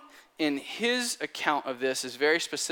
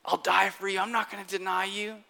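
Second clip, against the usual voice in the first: higher-pitched acted promise, dropping in volume; earnest vow; 5 semitones higher than usual. Peter tells his master and savior, earnest high vow. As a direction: swear loyalty in a raised, earnest pitch. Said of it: "You've just told your master".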